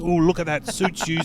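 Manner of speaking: lightly voiced sketch tailor; Paul Whitehouse. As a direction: imitate an admiring tailor. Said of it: "the tailor who would go".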